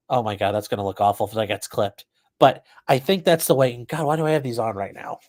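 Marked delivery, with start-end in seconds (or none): none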